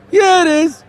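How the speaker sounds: high-pitched cartoon voice; Floyd the Floor Man. Floor cleaner confirming holy water works, high-voiced. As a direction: agree proudly in falsetto. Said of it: "this is Floyd the Floor Man".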